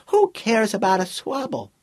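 put-on high child's voice, full of scorn; disdainful boy. A narrator's reading. Boy rejecting the inventor's swabble, high and disdainful. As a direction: pitch it well up and dismiss it like a kid. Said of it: "he said with disdain".

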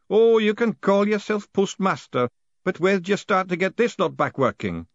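high-voiced old man reminiscing; wistful old master.

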